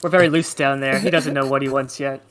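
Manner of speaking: ordinary talk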